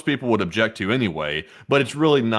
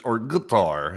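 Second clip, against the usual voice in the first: ordinary talk, nothing marked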